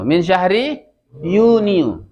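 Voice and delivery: slow Min syahri Yuniu with Yuniu sounded juniu; teacher models Arabic. Shown as a demonstration. Teacher modelling Arabic month names for pupils to repeat. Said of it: "Al-an istami' wa raddid".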